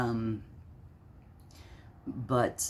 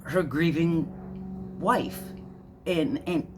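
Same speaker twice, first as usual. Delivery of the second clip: worked up; 8 semitones higher than usual. Real, not acted. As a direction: let the anger climb as you name them. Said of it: "Sorry, I'm getting all worked up".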